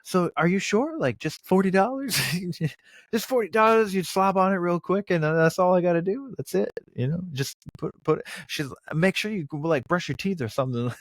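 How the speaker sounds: high, lightly voiced mock haggling; a hammed-up high imagined exchange; imitating the couple. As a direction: play out the deal in a put-on voice. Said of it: "he was like".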